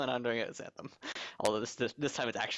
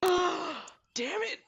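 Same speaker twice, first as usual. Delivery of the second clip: sharp gasp, then a high, loud curse; real annoyance bursting out at his own mistake; frustrated outburst; 4 dB louder than usual. Real, not acted. Player realizing his mistake, a high frustrated curse. Realizes a missed better transformation.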